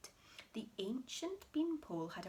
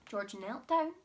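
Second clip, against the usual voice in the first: plain reading voice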